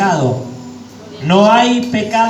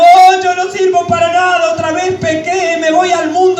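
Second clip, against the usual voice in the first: despairing sister; 7 semitones higher than usual. Retold or imitated. Pastor imitating a despairing sister, higher mock lament. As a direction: wail it like she's given up on herself. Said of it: "decía una hermana".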